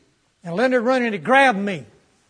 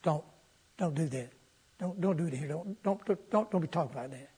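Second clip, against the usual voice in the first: quiet pleading; 4 semitones lower, 9 dB quieter than usual. Retold or imitated. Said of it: "I said".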